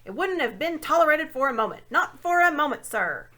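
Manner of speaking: put-on a deaf old dwarf shouting his grievance; shouting old dwarf who can't hear; loud old dwarf. A narrator's reading.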